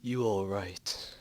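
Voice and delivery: low, slow gravelly drawl; Clint Eastwood voice. Acted. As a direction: growl it like a gunslinger. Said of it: "You sound like Clint Eastwood".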